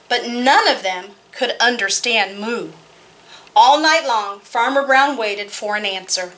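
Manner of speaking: put-on lively read-aloud for kids; story narration. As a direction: tell it brightly to a room of children. Reads picture book aloud.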